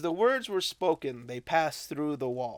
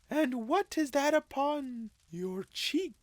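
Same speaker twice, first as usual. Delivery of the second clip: ghostly spirit voice; 12 semitones higher than usual. Acted. Ghost questions Scrooge.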